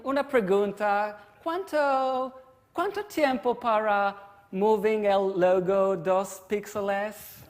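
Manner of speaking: put-on high, slow, drawn-out Spanish in an American accent. Project manager returns to question Jorge, high American-accented Spanish. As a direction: ham up an American speaking broken Spanish. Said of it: "doing it in American accent".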